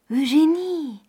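a high, bright call of joy; mother calling Eugénie in joy, high-pitched; joyful cry. A narrator's reading. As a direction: call her daughter with sudden delight. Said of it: "cria la mère en rougissant de joie".

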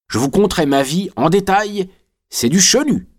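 very high, sing-out rogue's pitch; cheeky convict. A narrator's reading. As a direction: sell it high like a jaunty scoundrel.

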